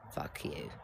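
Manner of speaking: the retort sunk to a near-silent whisper; whispered curse. Retold or imitated. John to Tony over the paid bill, a whispered curse. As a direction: drop it to a hiss of a whisper.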